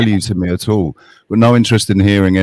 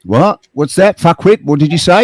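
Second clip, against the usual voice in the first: high, incredulous what-did-you-say; mock-incredulous questioning; mock disbelief; 5 semitones higher than usual. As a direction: pretend not to have heard, pitched up. Mocking feigned deafness in argument.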